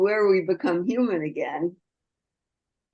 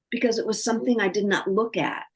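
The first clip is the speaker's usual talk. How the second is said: normal talking voice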